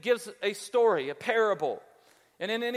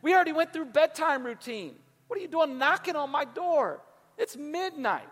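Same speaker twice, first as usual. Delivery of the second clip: high-pitched indignant protest; exasperated parent; 6 semitones higher than usual. Acted. Parent woken at midnight by knocking friend, exasperated. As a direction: complain in high, put-out exasperation.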